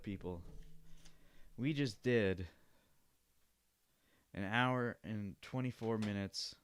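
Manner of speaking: ordinary talk, nothing marked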